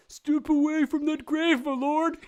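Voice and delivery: high, loud, drawn-out character voice; commanding unknown character. Acted. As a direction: call out a warning in a high put-on voice.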